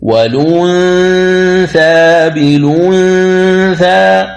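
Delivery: والأنثى with the ن hidden in a slow nasal hum. Shown as a demonstration. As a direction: hum the ن of الأنثى before the ث. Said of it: "إذن تقول".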